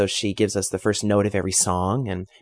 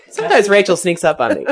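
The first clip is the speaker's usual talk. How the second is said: laughing; 12 semitones higher, 6 dB louder than usual. Real, not acted. Giggling after bandmate's joke.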